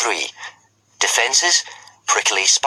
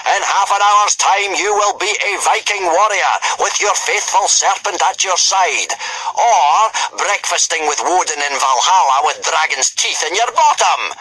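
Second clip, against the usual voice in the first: screaming with horrible enthusiasm; 11 semitones higher than usual. Acted. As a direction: relish every threat at full volume. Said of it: "screamed Gobber with horrible enthusiasm".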